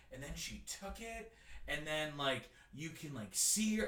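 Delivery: very faint, mic pulled away; barely audible, as if away from the mic; drifting off mic. Shown as a demonstration. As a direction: imitate a guide letting the mic wander away. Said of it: "do with the mic what he did".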